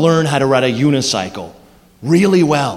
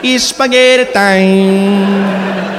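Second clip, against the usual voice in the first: slow, high Italian lullaby voice; Italian accent; 3 semitones higher, much slower than usual. Shown as a demonstration. Comic showing the audience the catchphrase to fall asleep to, Italian accent. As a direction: drift it out dreamily in an Italian accent. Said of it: "Say that catchphrase to yourself".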